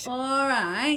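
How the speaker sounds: drawled mock northern all right; mock northern accent. Host repeating the greeting in mock northern accent.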